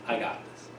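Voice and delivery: very soft, low aside; quiet coach taking over. Retold or imitated. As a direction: imitate a coach quietly stepping in. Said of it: "Her pitching coach stops me".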